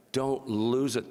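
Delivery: normal talking voice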